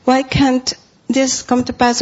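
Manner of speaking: normal talking voice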